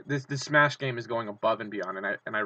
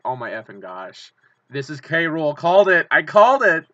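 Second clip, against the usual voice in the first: excited outburst; 4 semitones higher, 10 dB louder than usual. Retold or imitated. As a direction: start stunned, then shout the victory. Retold own reaction to reveal.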